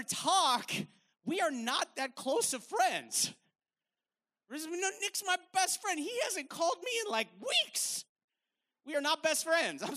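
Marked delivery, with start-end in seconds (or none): 4.5-8.0 a high, put-on voice of a clueless friend; lightly voiced clueless friend bragging; mock friend. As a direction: play the friend who thinks you're close. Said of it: "voicing a deluded friend".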